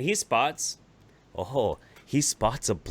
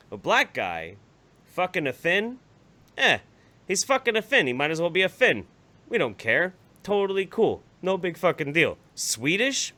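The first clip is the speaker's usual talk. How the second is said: mock uncle; 7 semitones higher, 3 dB louder than usual. Mocking uncle's logic.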